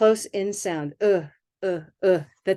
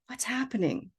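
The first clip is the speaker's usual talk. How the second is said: puzzled what's happening; 3 semitones higher, 7 dB quieter than usual. Shown as a demonstration. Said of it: "What's happening can also be more like".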